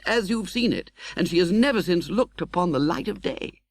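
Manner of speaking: put-on young man telling a tale. Young friend recounting Miss Havisham's past to Pip, lightly voiced. Herbert tells Pip the story.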